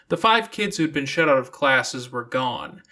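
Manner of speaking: ordinary delivery, nothing marked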